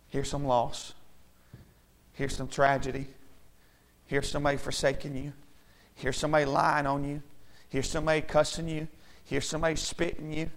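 low, soft, even litany of sufferings; subdued and low, item after item; hushed listing. Acted. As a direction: hand out each hardship quietly, evenly. Solemn list of sufferings handed out.

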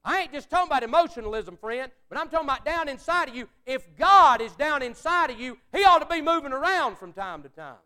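loud, high fiery preaching; full-force preaching. Acted. Preacher urging his church, loud and high. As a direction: pastor pounding it home to the congregation, loud and fervent.

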